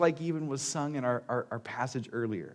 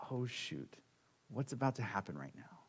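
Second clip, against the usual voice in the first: mock trembling; 12 dB quieter than usual. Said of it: "you gotta be trembling".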